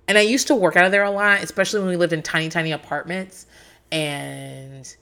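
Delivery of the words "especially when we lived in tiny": ordinary talk, nothing marked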